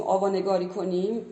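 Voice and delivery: ordinary talk, nothing marked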